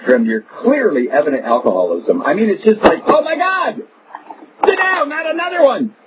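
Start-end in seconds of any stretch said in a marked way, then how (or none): 3.1-5.9 high, hammed-up cry of dismay; mock exasperated cry. The host mocks a listener fed up with Boner, a high hammed-up cry.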